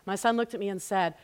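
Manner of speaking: normal talking voice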